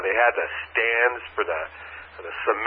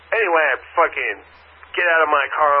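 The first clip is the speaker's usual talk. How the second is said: ordinary talk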